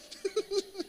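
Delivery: high, airy laugh after the joke; burst of laughter. Real, not acted. Pastor laughing at his own neighbor joke. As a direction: let a laugh break out high.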